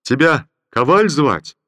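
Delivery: slow, loud question from a general; slow booming general asking a soldier's name; jovial general. Acted. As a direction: ask the soldier from horseback.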